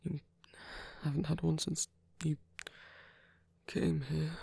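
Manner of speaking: ordinary talk, nothing marked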